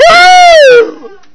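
very high, long screamed wail; anguished cry. Acted. Father refusing to sacrifice his daughter, anguished scream.

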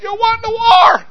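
put-on very high, thrilled child's cheer; excited little girl. Daughter cheering her father home from war, excited. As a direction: celebrate like a proud, delighted daughter.

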